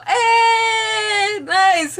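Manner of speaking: high, loud drawn-out hey; delighted cheer. Real, not acted. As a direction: cheer for a viewer's good news. Cheers a viewer watching live.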